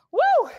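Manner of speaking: high loud woo to fire up the class; a big excited whoop; cheering whoop. Real, not acted. Coach cheering the class through the end of a set, loud whoop.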